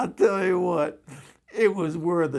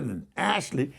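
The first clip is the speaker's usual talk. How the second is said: normal talking voice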